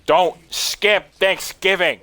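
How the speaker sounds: loud, high, slow mock scolding yell; hammed-up scream at a game villain. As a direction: yell it in mock outrage, drawn out. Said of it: "I was about to scream at him".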